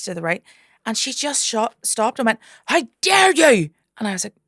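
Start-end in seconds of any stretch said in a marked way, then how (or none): 2.7-3.7 high, loud and slow, outraged; indignant heckler. Retold or imitated. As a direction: drag out the offended "how dare you". Heckler confronts comedian onstage.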